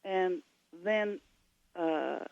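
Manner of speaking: ordinary talk, nothing marked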